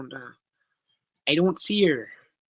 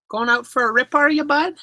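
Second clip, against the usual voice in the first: higher-pitched mock Canadian, rising on "bud"; Canadian accent; 7 semitones higher than usual. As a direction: playfully exaggerate a Canadian accent. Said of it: "Is that the Canadian accent".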